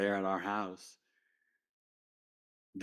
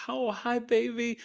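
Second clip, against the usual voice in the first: high, sugary greeting; sweet wife voice; 12 semitones higher than usual. Retold or imitated. Wife greeting him at his hospital bed, high and sweet. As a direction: coo it like a wife greeting her man. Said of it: "She's like".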